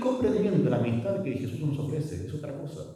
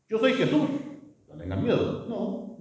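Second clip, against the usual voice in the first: mock call out; 8 semitones higher than usual. Imagined Jesus calling to frightened apostles.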